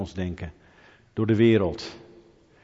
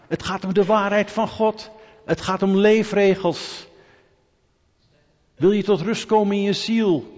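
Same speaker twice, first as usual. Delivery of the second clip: raised, much higher preaching voice; impassioned preaching; 8 semitones higher, 4 dB louder than usual. Real, not acted. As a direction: drive each point home at a high pitch. Preekt op hoge, dringende toon. (bevlogen preken met hoge stem)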